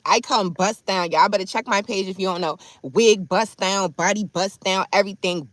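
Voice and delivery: ordinary delivery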